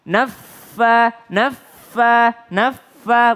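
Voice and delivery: NAFFA with a held F, then a short punched "fa"; doubled fa. Shown as a demonstration. Teacher drilling naffa, strongly doubled F. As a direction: pause on the F of NAFFA before releasing it. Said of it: "dari nun ke fa".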